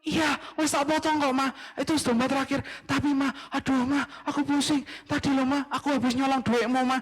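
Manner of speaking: high, fretful voice of a nervous dad; anxious father asking his wife; worried father. Acted.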